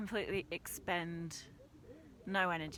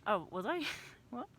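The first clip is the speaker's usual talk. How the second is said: higher, airy feigned innocence; mock innocent; 8 semitones higher, faster than usual. Climber pretending to Francis she wasn't rushing, mock innocent. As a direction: play dumb, all wide-eyed.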